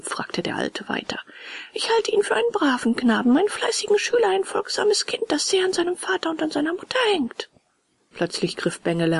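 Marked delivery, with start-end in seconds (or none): 1.7-7.5 high-pitched puppet voice; high voice, reeling off praise; lying puppet. A narrator's reading.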